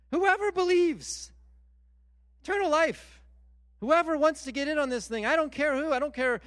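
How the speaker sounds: excited preaching. Real, not acted. Preacher building to God's invitation.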